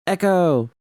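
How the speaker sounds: high, loud yell into the empty room; echoing shout. Acted. Judy breaking the tension in the empty studio, shouting. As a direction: call it out to hear the walls ring. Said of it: "my voice bounced off the walls".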